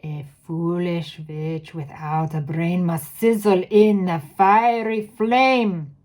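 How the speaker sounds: put-on loud, slow rising witch accent; Grand High Witch accent. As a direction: hurl the verdict in the witch accent. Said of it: "do the accent".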